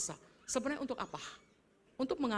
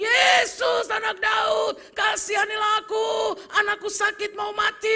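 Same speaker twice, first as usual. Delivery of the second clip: high, very loud begging cry; louder desperate shout; 13 semitones higher, 17 dB louder, slower than usual. Acted. Mother shouting louder as Jesus walks on. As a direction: yell so he can't ignore you. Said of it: "Dia teriak lebih kencang lagi".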